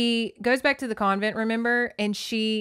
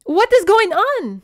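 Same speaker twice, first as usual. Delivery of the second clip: loud, high startled shout; alarmed cry; 11 semitones higher, 9 dB louder than usual. Retold or imitated. Passenger startled by the holdup, loud alarm. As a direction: shout out in shock.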